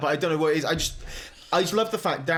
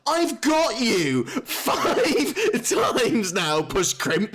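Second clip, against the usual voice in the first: high, drawn-out, laughing protest; mock exasperation; 9 semitones higher, much slower than usual. Player begging a recurring orc to go away, exasperated. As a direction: protest in hammed-up despair, stretching the words. Acting own plea to orc.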